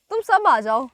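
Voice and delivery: बुला रही है सबको, ऊँची आवाज़ में; calling out. Real, not acted. Calling to others off camera. (ऊँची आवाज़ में पुकारना)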